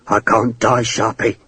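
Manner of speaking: low, subdued character plea; pleading. A narrator's reading. Naked prisoner to his enemy, low pleading. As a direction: plead quietly with your captor. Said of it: "Hakeswill pleaded".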